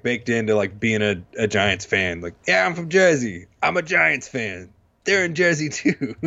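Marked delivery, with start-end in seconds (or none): 2.5-6.1 a hammed-up, higher New Jersey accent; terrible Jersey accent. Joking to cohost as a Giants fan, bad Jersey accent. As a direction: play a bad Jersey local, pitched up. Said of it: "That's a terrible Jersey accent".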